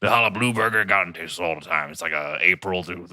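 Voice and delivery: rambling prank Texan voice; put-on Texan accent. Insisting to drive-through staff, Texan accent. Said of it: "I'm from, actually from Texas".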